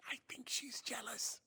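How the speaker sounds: whispered secret; confiding whisper. Acted. Ishu whispering gossip about a jealous cat. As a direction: whisper it just between us. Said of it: "just between us".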